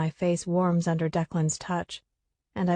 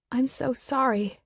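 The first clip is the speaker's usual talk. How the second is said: tearful, pitched up high; crying the apology out; choked, tearful apology; 6 semitones higher than usual. A narrator's reading. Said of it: "I cry".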